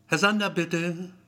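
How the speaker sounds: receptionist's loud, high call; calling a patient. A narrator's reading. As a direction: call out a name to the waiting room.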